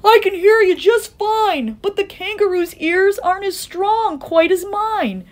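high, urgent elephant call; calling back. A narrator's reading. Horton urges the mayor to find shirkers, calling back. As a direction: press them with anxious questions. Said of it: "And Horton called back".

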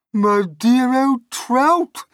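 high, loud, slow taunting aunt; Aunt Sponge crying out. Acted. Aunt Sponge taunting Aunt Spiker, slow, high and loud. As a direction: drag out the insult with relish. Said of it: "Aunt Sponge cried out".

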